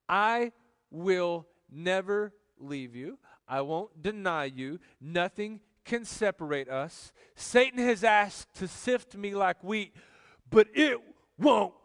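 slow, emphatic vow building to a forceful finish; defiant vow. Acted. Peter vowing to Jesus he won't deny him, defiant.

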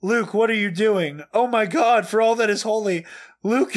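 high, lively dramatic reading of posts; frantic fan reaction. Acted. Host reads fan's reactions to friends, frantic. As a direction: read it like an overwhelmed fan.

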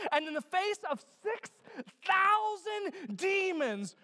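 high, driving preacher's voice; fervent preaching. Real, not acted. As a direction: build forcefully to the big number. Preaching at full force.

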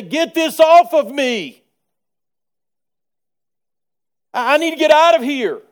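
loud, high desperate plea under weight; straining cry. Pretend. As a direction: cry out as if crushed under a load. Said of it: "and you would say".

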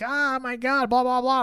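high mock panic; mock freak-out. Friend telling a horror movie plot, high mock panic. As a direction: squeal it like a panicking victim. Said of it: "Other dude's freaking out".

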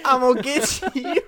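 high, teasing southern twang; Phantom Bell man. Pretend. Co-host playing the ghost bellman, high southern accent. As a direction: echo the mock threat in a southern accent.

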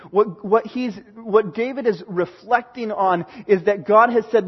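ordinary talk, nothing marked

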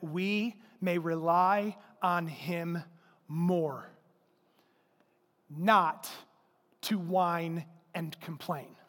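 slow, measured phrases with long pauses; slow dictation. Real, not acted. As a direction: spell out the point for note-takers. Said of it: "Write this down".

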